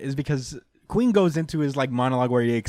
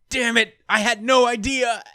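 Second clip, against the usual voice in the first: higher mock-dismayed cry; shocked; 8 semitones higher, 3 dB louder than usual. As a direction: play it startled and caught out. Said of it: "Sanji says".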